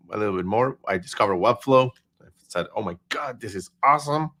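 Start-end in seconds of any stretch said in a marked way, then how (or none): none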